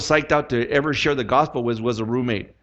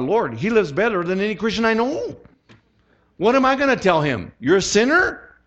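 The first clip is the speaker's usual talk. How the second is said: higher, rising to a disbelieving question; acted incredulous protest; 6 semitones higher than usual. Arguing with the Lord over witnessing to George, acted incredulous protest. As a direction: plead the case in mock disbelief.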